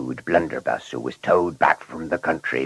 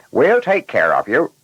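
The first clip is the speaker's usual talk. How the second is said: higher, louder chipper reply as a driver; reassuring driver; 7 semitones higher, 5 dB louder than usual. A narrator's reading. Driver telling the passenger they'll take care of him, reassuring. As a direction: play the driver promising good care.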